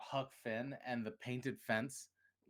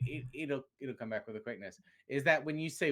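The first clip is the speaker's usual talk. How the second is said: ordinary talk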